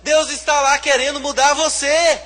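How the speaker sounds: loud, high preaching build; fiery preaching. Acted. Pastor preaching to the church, loud and high. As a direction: preach it hard to the congregation.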